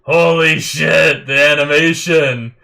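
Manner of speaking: floored by the animation; stunned awe. Real, not acted. Reacting to stunning fight animation.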